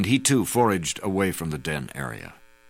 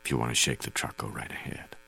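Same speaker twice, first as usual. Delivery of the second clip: low, hushed near-whisper; gently lowered voice, barely above a whisper; very soft; 7 semitones lower, 7 dB quieter than usual. A narrator's reading. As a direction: say it very softly, pitched low. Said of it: "I said very softly".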